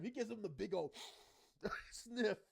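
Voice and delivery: loud, high, cracking up while telling it; can barely tell it for laughing. Real, not acted. Recapping the sniff gag to viewers, laughing.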